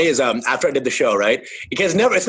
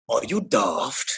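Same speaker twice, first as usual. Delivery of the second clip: mock Gordon Ramsay; 9 semitones lower, 6 dB quieter, much slower than usual. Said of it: "You'd be like".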